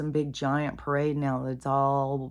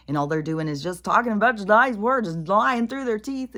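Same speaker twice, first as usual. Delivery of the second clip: loud high indignant rant; angry rant; 7 semitones higher, 7 dB louder than usual. Real, not acted. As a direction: rail against them loudly.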